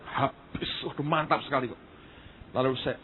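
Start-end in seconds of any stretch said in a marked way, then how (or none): 0.1-0.7 soft, voiceless mimicry; hushed imitation of a fellow minister; imitated preacher's exclamation. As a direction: breathe the word out for effect. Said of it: "hamba Tuhan ngomongnya".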